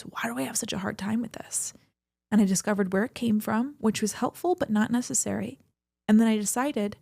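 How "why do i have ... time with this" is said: hushed, breathless whisper to herself; soft unvoiced whisper; whispered self-question. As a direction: whisper it like a private doubt. Retold inner questioning, whispered.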